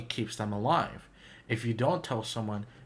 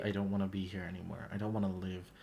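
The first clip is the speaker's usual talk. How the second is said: softer, lower, slightly hushed admission; quiet confession; 4 semitones lower, 7 dB quieter, faster than usual. Acted. Imagined confession to a listener, quiet and low. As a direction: say it low and soft, as if hard to say.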